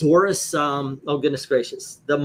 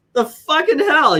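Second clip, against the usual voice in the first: amazed exclamation; 11 semitones higher, 6 dB louder than usual. Retold or imitated. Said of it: "I'm like".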